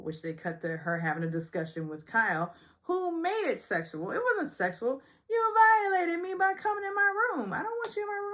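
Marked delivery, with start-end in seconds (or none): 5.3-8.3 high accusing imitation; high, indignant mock accusation; mock Sutton.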